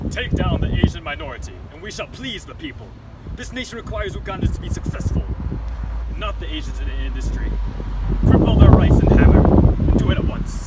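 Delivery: very loud furious orders; commanding dictator. Acted. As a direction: roar the order like a tyrant.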